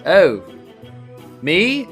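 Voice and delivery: ordinary talk, nothing marked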